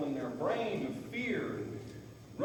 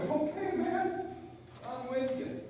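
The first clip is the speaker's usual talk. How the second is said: much higher pretend horse voice, relieved; horse's voice; 12 semitones higher than usual. Trainer voicing his mare agreeing to trust him, high pretend voice. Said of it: "And she said".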